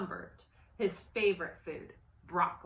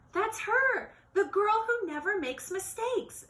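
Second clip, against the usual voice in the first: high, louder woman in the crowd; excited woman; 13 semitones higher, 5 dB louder than usual. Acted. A woman in the audience points her out, high and excited. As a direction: gush about her like a fan. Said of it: "said a woman".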